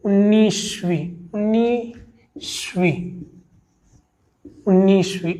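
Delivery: slow demo pronunciation. Shown as a demonstration. Teacher showing students उन्नीसवीं, said very slowly. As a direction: spell उन्नीसवीं out slowly by sound. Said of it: "ये हो गए आपके".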